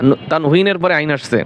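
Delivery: ordinary delivery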